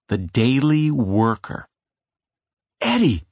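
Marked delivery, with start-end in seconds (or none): 2.8-3.3 high call across the yard. A narrator's reading. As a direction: call him from a distance. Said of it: "I called".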